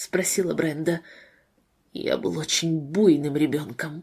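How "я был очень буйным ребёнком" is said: low, soft man's voice; hushed, subdued admission; whispered confession. A narrator's reading. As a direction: confess it under the breath. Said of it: "прошептал Дэвид".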